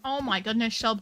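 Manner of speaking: ordinary talk, nothing marked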